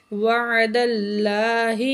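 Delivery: اللہ with a full, heavy lam; heavy Allah. Shown as a demonstration. Teacher models heavy Allah after a fatha, slow recitation. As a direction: round and thicken the lam of اللہ. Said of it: "موٹا کر کے پڑھیں گے".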